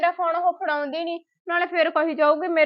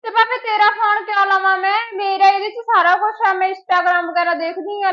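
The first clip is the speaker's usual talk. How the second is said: loud, higher, slowing on the last line; indignant refusal; 4 semitones higher, 6 dB louder than usual. Acted. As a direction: scoff at the offer of her phone. Quarrel over phone. (ਖਿਝ ਕੇ ਉੱਚੀ ਇਨਕਾਰ)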